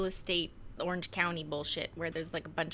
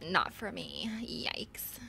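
put-on Valley Girl accent; 3 semitones higher than usual. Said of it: "Your next accent should be California Valley Girl".

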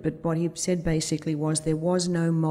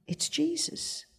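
high, hushed and slow; soft-spoken; 11 semitones higher, 8 dB quieter, slower than usual. Retold or imitated. Old Greek reporting the baby's name, soft-spoken. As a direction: breathe the name softly and slowly, high in the voice. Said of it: "is very soft-spoken".

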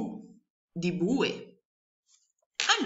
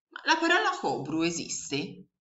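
ordinary talk

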